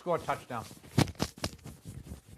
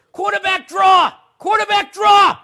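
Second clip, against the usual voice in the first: shouting at the TV; 21 dB louder, slower than usual. Real, not acted. Yelling at the game on TV.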